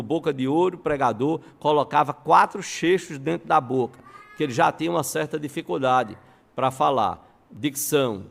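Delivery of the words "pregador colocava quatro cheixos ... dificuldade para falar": ordinary talk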